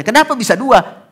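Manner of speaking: high, loud question; father pressing his son, pitched high; demanding father. Retold or imitated. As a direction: push the child for an answer. Father asking again.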